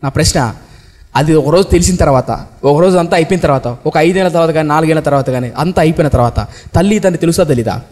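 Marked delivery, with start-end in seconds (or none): none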